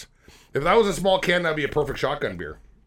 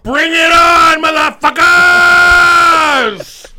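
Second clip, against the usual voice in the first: high, all-out drawn-out yell; bellowed challenge; 13 semitones higher, 14 dB louder, much slower than usual. Acted. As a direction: scream a hammed-up fight challenge, stretching the last word. Mock challenge to rival podcasters.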